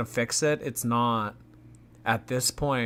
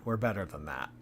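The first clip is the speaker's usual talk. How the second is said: quieter repeat, a touch lower; We're better than that, murmured a second time; softer echo; 4 semitones lower, 5 dB quieter, faster than usual. Shown as a demonstration. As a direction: let it fall away softly to yourself.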